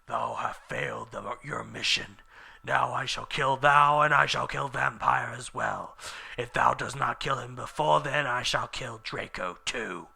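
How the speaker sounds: dark menacing villain speech; Voldemort threatening Ebony. Acted.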